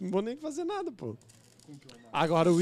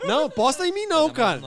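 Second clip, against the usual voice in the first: laughing loud plea; 6 semitones higher, 5 dB louder than usual. Real, not acted. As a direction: beg through laughter. Begging chat not to bet.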